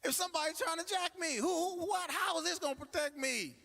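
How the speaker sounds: high, incredulous disbelief; high mock disbelief at the teller. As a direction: ask it like you can't believe the offer. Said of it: "I'm like".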